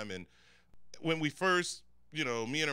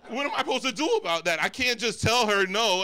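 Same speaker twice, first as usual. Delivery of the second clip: raised, louder comic exasperation; exasperated; 9 semitones higher, 10 dB louder than usual. Acted. Comedian pleading with the audience, loud and exasperated. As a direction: throw up your hands, loudly. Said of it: "building comic outrage".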